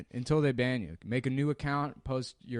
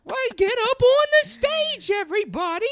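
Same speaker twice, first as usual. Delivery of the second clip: high-pitched caricature; 14 dB louder than usual. Retold or imitated. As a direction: shriek the invite in a cartoon voice. Imitating Irwin calling people up.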